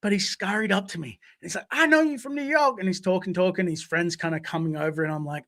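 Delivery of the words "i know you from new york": high-pitched imitation of a street hustler; squeaky impression, at normal volume. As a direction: do the little guy, pitched way up. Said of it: "I'm about to do his voice".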